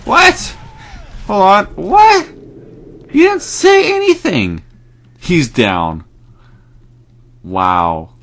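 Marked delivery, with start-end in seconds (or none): 0.1-4.6 high, loud outburst of disbelief; shrieked protest at the game, drawn out; indignant shouting. Real, not acted. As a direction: yell it in outraged surprise.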